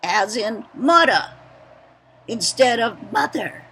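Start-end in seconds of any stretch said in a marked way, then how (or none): 0.8-1.3 "mudda" with a d for th and an open ah for the R; Pidgin mudda. Shown as a demonstration. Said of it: "the R is dropped for the A, as in mudda".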